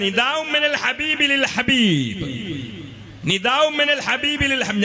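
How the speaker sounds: high, ringing intoned phrase; Arabic recitation. Acted. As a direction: proclaim it high, stretching the endings. (ഉയർന്ന സ്വരത്തിൽ അറബി ഉദ്ധരണി)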